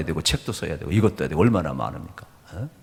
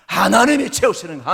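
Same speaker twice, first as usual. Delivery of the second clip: fervent preaching; 10 semitones higher, 5 dB louder than usual. Acted. Preaching climax.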